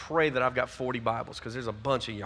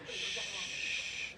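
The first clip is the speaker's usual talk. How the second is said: soft unvoiced shh; shushing; 13 dB quieter than usual. Real, not acted. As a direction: hush the room. Quieting a crowd of students.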